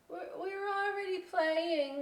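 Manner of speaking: high, slow brush-off; lightly voiced dismissive bat. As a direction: turn him away lightly. Other bats rejecting him.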